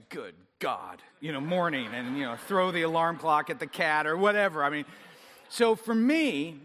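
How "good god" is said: low, soft grumpy mumble; half-asleep grouch, much lower; groggy groan. Retold or imitated. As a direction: grumble it like you just woke up. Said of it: "I wake up and say".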